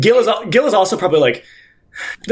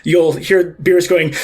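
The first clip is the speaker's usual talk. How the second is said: ordinary delivery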